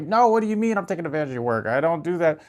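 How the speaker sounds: high, fast, indignant protest; defensive retort. Retold or imitated. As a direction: snap back defensively. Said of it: "If I got defensive, I was like".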